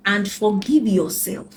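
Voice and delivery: ordinary talk, nothing marked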